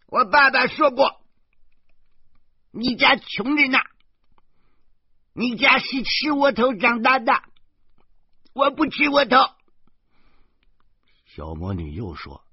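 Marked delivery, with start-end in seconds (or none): 0.1-9.4 put-on much higher little-girl voice, louder and taunting; a spoiled kid sneering; snooty child voice. Girl mocking her guardian's poor family, snooty.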